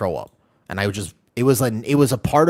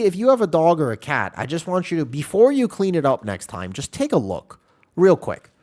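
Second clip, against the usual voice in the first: higher, sing-song earnest plea; mock pleading; 9 semitones higher than usual. Real, not acted. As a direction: coax them gently, half joking. Pleading with chat about soup.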